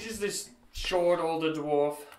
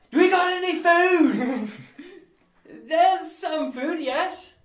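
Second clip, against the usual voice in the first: high, loud serving-woman voice; cheerful young woman, pitched well up; 11 semitones higher, 8 dB louder, slower than usual. Acted.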